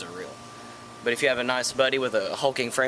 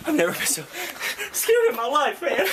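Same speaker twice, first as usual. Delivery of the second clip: shaken; 13 semitones higher, 5 dB louder than usual. Pretend. After the fright.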